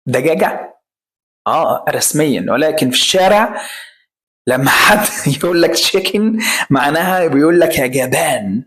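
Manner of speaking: usual speaking voice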